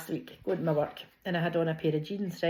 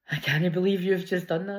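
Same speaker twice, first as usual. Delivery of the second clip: quoted husband; 3 dB louder than usual. Retold or imitated. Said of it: "he just went".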